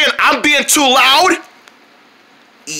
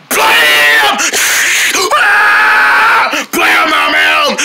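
mouthed guitar blasts; 5 semitones higher, 4 dB louder, much slower than usual. Pretend. Player burning their ears, loud guitar blasts and shriek. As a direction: blast out guitar noises with your voice.

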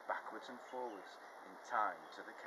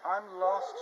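higher, louder mild wail; 10 semitones higher, 11 dB louder than usual. Acted. Spinderella stranded outside, a mild wail. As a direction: cry it out, lost and alone. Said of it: "she wailed".

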